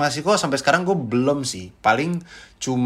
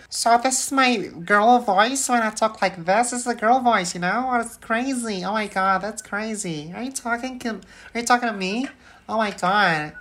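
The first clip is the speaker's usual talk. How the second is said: put-on higher, slowed Valley Girl accent; bubbly Valley Girl accent, like Kim Kardashian; 8 semitones higher, slower than usual. Shown as a demonstration. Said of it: "put a Valley Girl accent".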